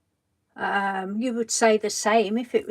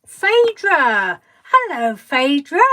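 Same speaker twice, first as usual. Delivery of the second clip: calling out a name, much louder and higher; excited greeting; 4 semitones higher, 9 dB louder, slower than usual. Real, not acted. Greeting a viewer who just joined the stream, delighted. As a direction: call her name out with delight.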